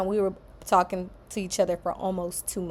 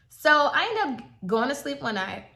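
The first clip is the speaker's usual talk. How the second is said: normal talking voice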